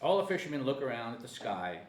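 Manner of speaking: ordinary talk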